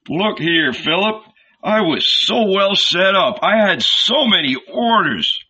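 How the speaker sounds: complaining cobbler. A narrator's reading. Nathanael complains to Philip about leaving his trade. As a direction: whine about the business he'd lose.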